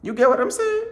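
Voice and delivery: sudden squeaky falsetto question; high falsetto. Real, not acted. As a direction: pop up into falsetto.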